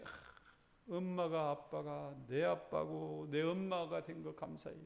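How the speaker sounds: put-on grateful child.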